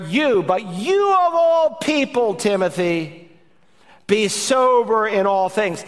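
preacher hammering YOU, pitch leaping up; emphatic. Shown as a demonstration. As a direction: stress YOU hard, as if singling out one person. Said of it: "It's emphatic. You could render it".

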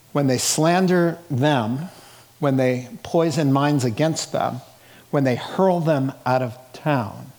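normal talking voice

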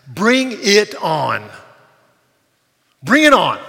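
very loud, drawn-out challenge; rallying cry. Real, not acted. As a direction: shout it like a battle cry.

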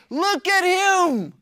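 mocking accusers. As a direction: mimic the scornful accusers pointing at him. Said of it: "they say".

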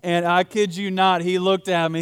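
ordinary talk, nothing marked

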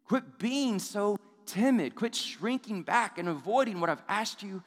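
a fed-up father demanding courage; frustrated God. Retold or imitated. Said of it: "You can sense that frustration".

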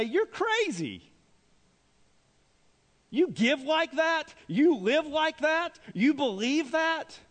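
high, slow, drawn-out mock astonishment; acted incredulous disbelief. Preacher voices the world judging Christians, incredulous. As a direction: stretch each question in scandalized disbelief.

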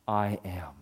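breathless whisper of I am. Acted. Preacher whispering Jesus's claim to his opponents. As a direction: whisper it like a holy secret.

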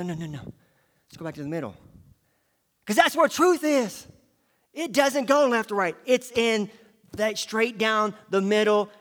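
usual speaking voice

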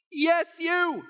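God's firm reply. Acted. God insists to Peter.

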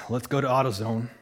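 lightly voiced low Southern man; understated Southern mechanic; deep Southern voice. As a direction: drop it a little deep and Southern, softly. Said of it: "like, deep Southern voice".

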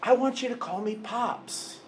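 a loving father asking to be called Pops; God the Father, inviting. Acted. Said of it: "And yet God says".

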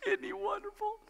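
put-on high, soft cooing over the baby; doting parent. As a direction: gush softly over the crib. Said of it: "You go".

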